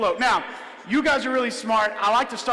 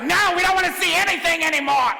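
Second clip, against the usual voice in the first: higher, louder mock whine from a bored kid; whiny kid; 7 semitones higher, 4 dB louder than usual. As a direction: whine like a fed-up kid in the crowd. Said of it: "Some of you are like".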